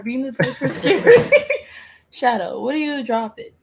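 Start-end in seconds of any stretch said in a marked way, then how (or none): 0.0-1.3 loud, higher, breaking into laughter; laughing. Real, not acted. Apologising mid coding session, laughing. 1.4-1.8 very high giggle; real laugh. Real, not acted. Apology dissolving into high laughter.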